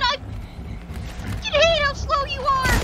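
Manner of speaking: high exasperated yell; frustrated yell. Real, not acted. Yelling at slow partner.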